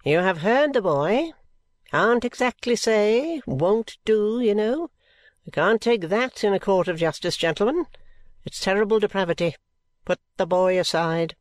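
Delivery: a self-important coroner; pompous coroner. A narrator's reading. Reads coroner's speech.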